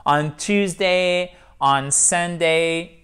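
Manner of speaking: "On Tuesday", "on Sunday" spelt out slowly; slow model English. Shown as a demonstration. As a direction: read the examples slowly. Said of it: "c'est la préposition pour introduire le jour". (exemples en anglais lents)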